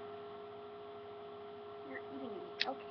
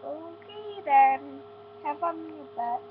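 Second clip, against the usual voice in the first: sarcastic; 5 semitones higher, much slower than usual. Retold or imitated. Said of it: "I'm like".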